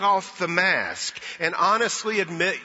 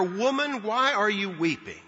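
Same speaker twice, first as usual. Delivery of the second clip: higher-pitched, curious angel asking; puzzled, inquiring lift; curious question; 4 semitones higher than usual. Retold or imitated. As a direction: ask it out of curiosity, pitched up on the first word. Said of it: "angels ask out of curiosity".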